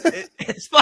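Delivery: high, loud laughing rant; laughing protest. Real, not acted. Cohost defending his cough to producer, laughing high protest.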